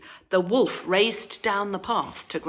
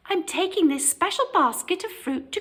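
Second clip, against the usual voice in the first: put-on little girl voice; 11 semitones higher, faster than usual. A narrator's reading. Little Red speaks.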